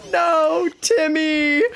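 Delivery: high, loud cry of horror; anguished scream. Retold or imitated. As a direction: shriek the child's name in panic. Parents scream in the video.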